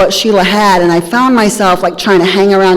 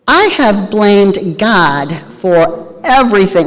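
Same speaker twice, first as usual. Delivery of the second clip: ordinary delivery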